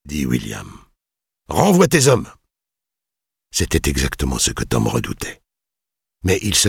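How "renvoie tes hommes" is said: high, loud lordly command; imperious order. A narrator's reading. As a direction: order it like an arrogant lord. Lord orders the men dismissed. (ordre hautain et sec)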